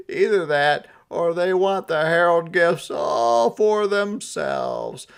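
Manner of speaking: high, loud put-on Santa voice. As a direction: play him sulky and fed up.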